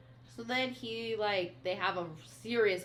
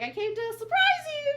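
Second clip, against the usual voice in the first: mock sweet; 8 dB louder than usual. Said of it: "And then he's like".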